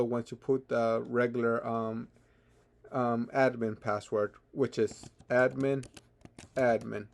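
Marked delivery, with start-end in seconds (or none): none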